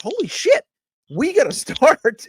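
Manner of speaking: high, laughing "holy shit" realisation; shocked exclamation. Retold or imitated. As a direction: blurt it out in amused disbelief. Retelling own shocked realisation.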